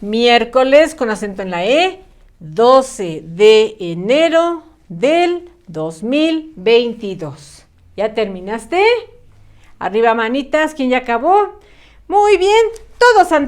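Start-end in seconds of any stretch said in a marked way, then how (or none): 2.4-7.7 date read out very slowly, word by word; slow dictation. Shown as a demonstration. Teacher reads the full date slowly as the child copies. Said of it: "Y ahí está nuestra fecha completa".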